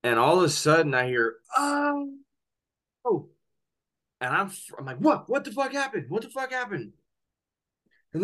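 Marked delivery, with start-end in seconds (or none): 1.6-3.3 imitated high-pitched gasp of shock; high, drawn-out shocked "oh"; shocked cry. Retells sudden cries overheard. 5.0-6.9 high-pitched retold alarm; acting out startled disbelief, very high; frantic questioning, replayed in a high voice. Retells own alarmed reaction.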